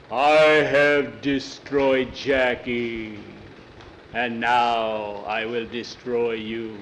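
put-on a kid faking a scary monster. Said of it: "Jackie changed his voice".

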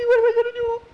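very high, loud falsetto wail; hammed-up squeaky panic; panicked sidekick. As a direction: squeal it in comic terror. Frightened Skeeter about to be found.

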